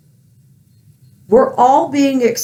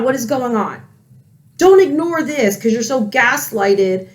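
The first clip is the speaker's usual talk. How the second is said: ordinary talk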